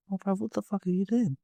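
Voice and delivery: high, incredulous outburst at the driver; exasperated. Retold or imitated. Yelling at dad during the police chase, high exasperated. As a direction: disbelief at dad's driving.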